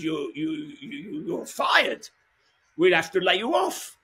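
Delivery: put-on slow stammer then high French accent; French accent, stuttering then high; French manager accent. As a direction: mimic the odd French manager firing him. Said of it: "And that's how he spoke".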